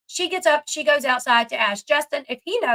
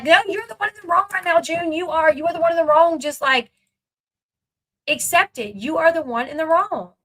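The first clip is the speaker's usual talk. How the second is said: real exasperated rant at the TV; heated scolding; 4 semitones higher than usual. Real, not acted. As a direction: call her out hard, no patience. Scolding June on screen.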